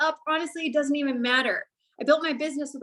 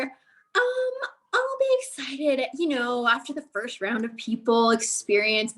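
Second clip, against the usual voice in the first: hesitant doubter; slower than usual. Retold or imitated.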